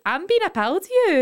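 high-pitched retold protest; exasperated protest. Retelling Dale's exasperated protest in an argument.

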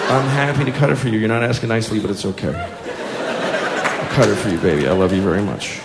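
low, hushed, patient dad; placating, not engaging. Acted. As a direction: keep soft and even with a tyrant toddler. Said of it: "I'm still not engaging".

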